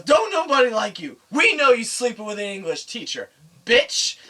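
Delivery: loud, high insult at the principal; angry outburst. Retold or imitated. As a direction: act out a kid lashing out, yelling. Acted outburst at laughing principal.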